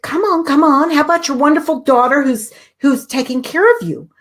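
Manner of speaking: high, quick, eager prodding; urgent coaxing. Retold or imitated. Daughter urgently coaxing her panicked mother, high and eager. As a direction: hurry her along before she thinks. Urging mother to list gratitude.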